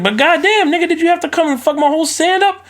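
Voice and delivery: high-pitched, disbelieving outburst; exasperated complaint. Retold or imitated. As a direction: squeal it in outrage at the ruined stand. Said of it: "He was probably like".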